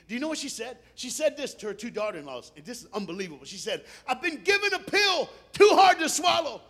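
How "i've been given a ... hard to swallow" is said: loud, high shout of bitter grief; anguished outcry. Acted. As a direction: cry it out at full force. Acts out Ruth's bitter cry.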